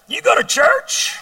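high, drawn-out incredulous question. Retold or imitated. Teen to his weed-smoking buddy who invited him to church, incredulous. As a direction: squeal it slowly in disbelief.

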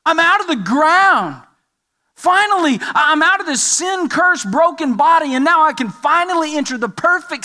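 high, loud exultant cry; triumphant preacher's shout of relief; excited, jubilant. Acted. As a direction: proclaim it like someone just set free. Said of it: "well-fed and healthy and strong and excited".

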